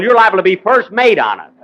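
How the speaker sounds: ordinary delivery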